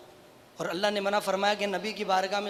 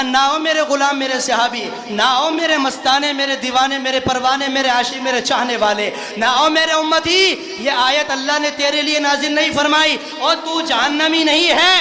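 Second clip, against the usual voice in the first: loud, raised-pitch impassioned proclamation; fervent mercy; 5 semitones higher, 10 dB louder than usual. Retold or imitated. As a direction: pour out reassurance at full force, swelling as it goes. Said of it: "दरिया रहमत जोश में आया".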